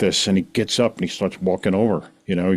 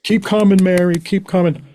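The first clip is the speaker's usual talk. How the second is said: high, raised-voice cheer; group encouragement; 9 semitones higher, 4 dB louder than usual. Retold or imitated. Meeting members clapping for Mary, imitated encouragement. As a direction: call it out like a supportive crowd.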